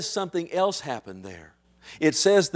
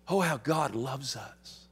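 ordinary talk

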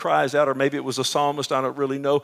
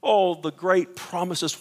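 usual speaking voice

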